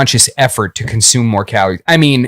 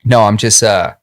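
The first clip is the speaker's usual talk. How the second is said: usual speaking voice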